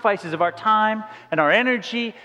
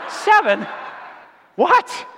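high, loud burst of disbelief; shocked disbelief; 9 semitones higher, 3 dB louder, slower than usual. Retold or imitated. To his buddy, stunned at the seven dollar price. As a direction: blurt it out, stunned. Learning the friend paid seven.

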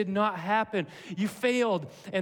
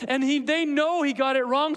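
usual speaking voice

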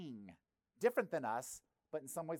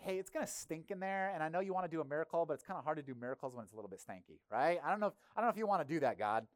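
casual Martha; 4 dB louder, faster than usual. Retold or imitated. Martha nervously warning Jesus about the smell, fast and chatty. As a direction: rattle it off like an awkward friend. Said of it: "But she's like".

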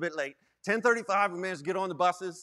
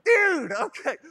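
higher, louder exasperated "Dude"; exasperated outburst; 10 semitones higher, 7 dB louder, slower than usual. Retold or imitated. Youth pastor reacting to Marley, an exasperated loud outburst. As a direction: blurt it out in disbelief.

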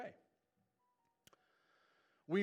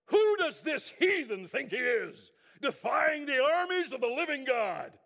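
indignant shout; 7 semitones higher, 10 dB louder than usual. Acted. David's outraged challenge.

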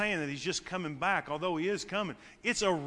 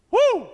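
high, loud joyful whoop; laughing whoop; 11 dB louder than usual. Real, not acted. Preacher whooping with joy after his own line. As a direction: let out a happy laugh and holler.

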